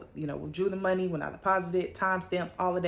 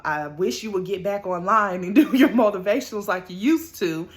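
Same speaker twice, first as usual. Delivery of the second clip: laughing while quoting; 3 semitones higher, 8 dB louder than usual. Retold or imitated. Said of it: "She was like".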